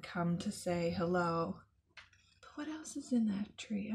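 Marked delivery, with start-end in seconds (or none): 2.4-3.9 quick secretive whisper. Real, not acted. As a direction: hush and ask as if sharing a secret. Whispers question to children.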